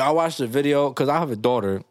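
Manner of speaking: normal talking voice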